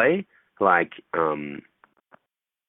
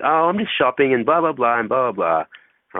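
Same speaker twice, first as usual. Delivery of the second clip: higher-pitched mock girl rattling on; lilting, higher put-on chatter; chatty girl; 4 semitones higher than usual. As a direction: imitate a girl prattling about her day. Voicing the girl's chatty answer.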